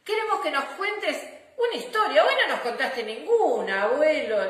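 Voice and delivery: put-on high, bright children's pleading; little girls voice. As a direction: beg for a story like kids. Girls ask for a story.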